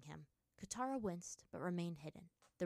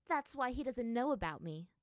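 smirking; 6 semitones higher, 5 dB louder than usual. Acted. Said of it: "Katara smirked".

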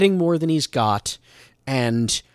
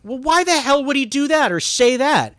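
higher-pitched, incredulous complaint; exasperated viewer; 9 semitones higher, 3 dB louder than usual. Acted. Viewer objecting to an out-of-character moment, exasperated. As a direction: demand to know why, baffled. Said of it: "I'm sitting there going".

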